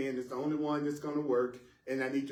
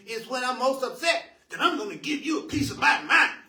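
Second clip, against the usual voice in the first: high, loud mock outburst; hammed-up upset, pitch climbing as it goes; mock telling-off; 6 semitones higher, 4 dB louder than usual. Acting out an upset person about to give a piece of their mind, mock telling-off.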